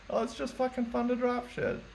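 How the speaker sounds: high, breezy quote of a younger self; carefree past self. Retold or imitated. Musician quoting his old carefree self, high and breezy.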